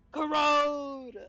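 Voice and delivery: shouted callout. Real, not acted. Calling out the status effect.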